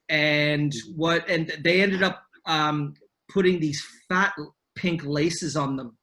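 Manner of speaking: ordinary talk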